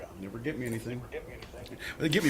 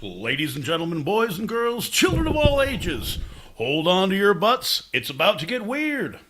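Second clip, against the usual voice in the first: grand entrance announcement; 6 semitones higher, 7 dB louder than usual. Pretend. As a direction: announce it like a circus ringmaster. Showman opening of the podcast.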